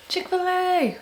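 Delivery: high, slow, laughing call of Chick-fil-A; excited cheer. Real, not acted. Reader heading off to dinner, excited laughing call. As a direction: sing out Chick-fil-A with glee.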